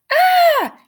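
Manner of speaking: sudden high shriek of fright; startled scream. A narrator's reading. Narrator voicing a shocked cry. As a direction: yelp like something swoops at you. Squirrel attack cry in story.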